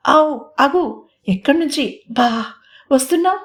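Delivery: flustered elder sister failing to scold; flustered stammer. A narrator's reading. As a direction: try to sound angry but fumble it. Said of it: "కోపం బదులు తడబాటు గొంతులో".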